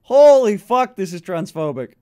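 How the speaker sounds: ordinary talk, nothing marked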